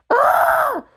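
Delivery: short high cry of dismay; wife cries out at the firing, high and sharp. A narrator's reading. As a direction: yelp out in shock. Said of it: "cried Anne".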